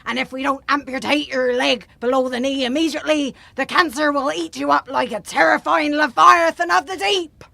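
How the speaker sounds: put-on doctor's diagnosis in pirate accent. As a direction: break bad news like an old sea dog. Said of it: "while talking like a pirate".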